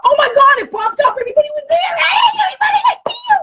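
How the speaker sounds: very high, loud, laughing shriek; ecstatic squeal. Real, not acted. Host seeing viewers appear at last, ecstatic squeal. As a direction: squeal with joy as the comments pop up. Livestream comments finally appear.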